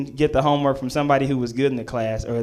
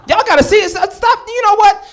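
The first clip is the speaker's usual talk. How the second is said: defensive to crowd; 14 semitones higher, 7 dB louder than usual. Real, not acted. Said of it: "it wasn't my fault".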